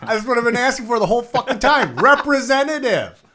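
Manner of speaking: loud, high, furious outburst; hammed-up rage at customer service; exasperated shout. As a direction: yell at the useless bot, stretching the last word. Acting out furious reply to chatbot.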